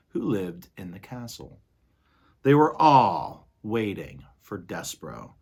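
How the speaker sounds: lively read-aloud voice; story narration. A narrator's reading. Teacher reading the novel aloud to students.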